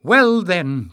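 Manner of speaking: high, loud and pouncing; triumphant cry. A narrator's reading. Tyrrell winning over the crowd, triumphant cry. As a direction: seize the point at full voice. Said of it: "Tyrrell cried".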